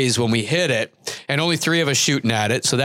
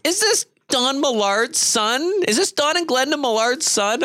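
put-on higher, slowed, curious older lady voice; imitating an older woman; 10 semitones higher than usual. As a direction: voice her like a wife asking politely. Wife asking on Facebook, voiced.

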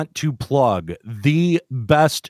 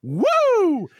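high shrill wrestling woo; Ric Flair woo. Pretend.

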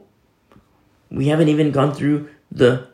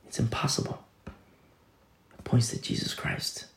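slow whispered conclusion; 11 dB quieter, slower than usual. Acted. Preacher pointing the church to Jesus Christ, whispered. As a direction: whisper it slowly as the answer.

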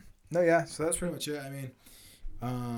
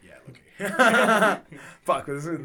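laughing; 5 semitones higher, 8 dB louder, much slower than usual. Real, not acted. Laughing embarrassed.

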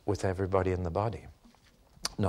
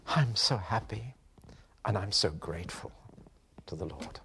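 hushed reverent quote; 5 dB quieter than usual. Retold or imitated. Preacher reading a martyr's letter to the congregation, hushed. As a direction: breathe the words like a treasured letter. Quoting prisoner's letters from Dachau.

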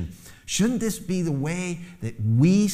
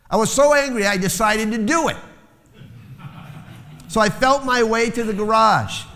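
high, loud, urgent storytelling; fiery preaching; 9 semitones higher, 4 dB louder than usual. Acted. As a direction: drive the story hard and loud. Intense preaching retelling story.

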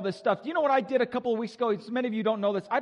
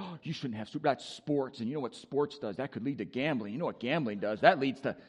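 mock prude; 10 semitones lower, 6 dB quieter than usual. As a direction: lower and softer scolding killjoy.

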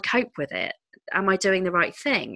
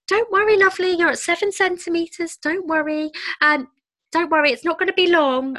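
much higher, sing-song reassuring midwife; imitating a chirpy, reassuring midwife; soothing midwife; 12 semitones higher, 5 dB louder than usual. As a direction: pat her on the head with your voice, pitched way up. Midwife soothing at seven centimetres.

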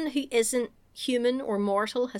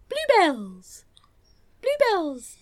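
a hint of a gormless kid, rising high; gormless answer; 10 semitones higher, 6 dB louder, much slower than usual. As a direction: say it dopily, pleased with yourself. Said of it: "looks really gormless and goes".

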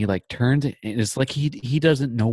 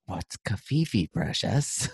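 slow, softer creature voice fading into a whispered precious; Smeagol voice; 5 dB quieter, much slower than usual. Retold or imitated. Serkis asking the host, in hushed Smeagol voice. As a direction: creep over the word, then breathe out precious. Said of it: "Can you read these in Smeagol's voice".

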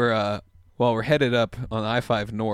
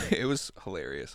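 ordinary talk

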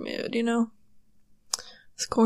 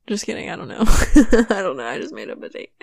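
normal talking voice

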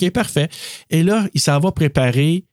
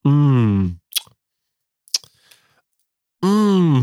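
drawn-out pleased hums; mock savoring hum. Imitating the diner tasting the burger, pleased hums. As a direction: savour an imagined bite.

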